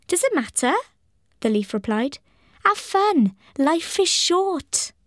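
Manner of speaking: high, airy, carefree leaf. Acted. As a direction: sing it out like a breezy sprite. Carefree maple leaf speaks.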